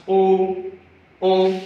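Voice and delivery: O said as a rounded long "oh"; vowel demo. Shown as a demonstration. Teacher demonstrating the long O, a rounded "oh". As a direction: model the O sound twice. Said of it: "It sounds as".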